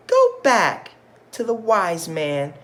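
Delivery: high, loud nagging wife; high-pitched cross wife ordering him back; wife's voice, loud. Acted. Wife voiced in read-aloud story.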